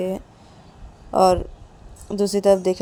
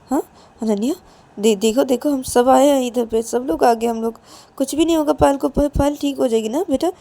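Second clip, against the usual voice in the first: higher, halting coaxing voice; comforting mother; 5 semitones higher, 4 dB louder than usual. Acted. Mother telling Ananya all will be well, coaxing. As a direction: reassure a child with lifted, questioning endings.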